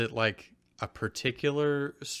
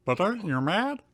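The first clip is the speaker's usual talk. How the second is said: high, lightly Kermit-ish frog voice; 7 semitones higher than usual. Acted. Drew asking Ash if he's mad, Kermit voice. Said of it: "This started as trying to be Kermit".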